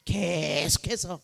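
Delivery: 계속해서 very slow, first syllable held; drawn-out emphasis. Acted. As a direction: let 계속해서 drag on and on. Stretches word to show endless repetition.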